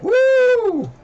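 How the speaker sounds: high, loud whoop of joy over the nice weather; excited whoop. Retold or imitated. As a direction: let out a big cheering woo. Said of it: "and it's like".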